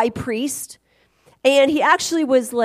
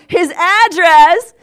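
His address, slow, high and much louder; dramatic reveal; 6 semitones higher, 9 dB louder, much slower than usual. Acted. Telling the crowd she found his address, slow dramatic emphasis.

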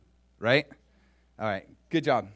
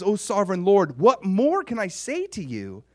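awed address to God; 8 semitones higher, 9 dB louder than usual. Acted. David at a loss before the Lord, loud awe. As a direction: call out as if words run out. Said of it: "I love what he says here".

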